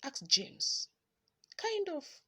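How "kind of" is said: yelling. A narrator's reading. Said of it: "Sally yelled".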